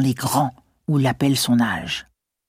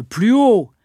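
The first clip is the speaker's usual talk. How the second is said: sharp shout, higher and louder; teacher shouting; 7 semitones higher, 6 dB louder than usual. A narrator's reading. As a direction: bark the order at the mumbling boy. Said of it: "cria le maître".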